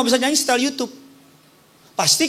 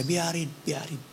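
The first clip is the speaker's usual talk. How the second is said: mother comforting; 12 semitones lower, 6 dB quieter than usual. Retold or imitated. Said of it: "Dia bilang sama saya".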